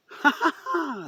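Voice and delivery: high, fake ha-ha-ha; mock laugh. Vlogger taunting Democrats who won, mock laughter. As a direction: force out a sarcastic laugh.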